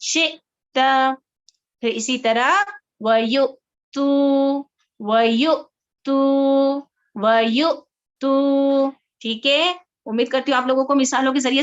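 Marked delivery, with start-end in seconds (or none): 0.0-1.1 फ़तू very slow, with a small stop before तू; tiny jolt in the middle of फ़तू; slight catch on hamza. Shown as a demonstration. Teacher shows students the stop at hamza sakina. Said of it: "कैसे". 3.0-8.9 फ़तू very slow, with a small stop before तू; tiny jolt in the middle of फ़तू; slight catch on hamza. Shown as a demonstration. Teacher shows students the stop at hamza sakina. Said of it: "हल्का सा झटका".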